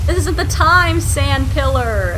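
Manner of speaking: loud, high frustrated yelling; yelling while running away. Real, not acted. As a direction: yell at the sand pillar while fleeing. Fleeing sand pillar, frustrated.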